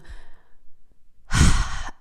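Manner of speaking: long breathy sigh; real pause-filling sigh. Real, not acted. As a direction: let out a long exhale. Pauses to gather her thoughts.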